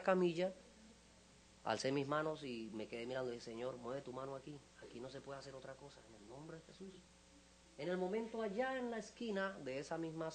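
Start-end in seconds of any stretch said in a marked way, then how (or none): 3.4-6.9 very soft, almost private plea; hushed prayer. Retold or imitated. Pastor prays over the injured boy's stretcher, hushed prayer. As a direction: pray it under your breath.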